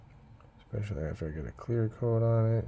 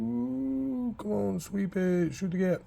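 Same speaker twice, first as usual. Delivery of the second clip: excited cheering; 10 semitones higher than usual. Real, not acted. Cheering on the brush stroke.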